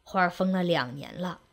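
low, soft admission of a troubled father; heavy confession. A narrator's reading. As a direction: let it out quietly after long silence. Father confesses after long silence.

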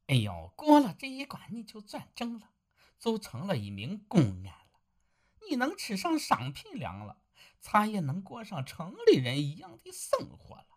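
wife cheers him up about a better life; encouraging wife. Acted. Wife encourages her husband. (妻子声音，略高略轻，温和劝慰)

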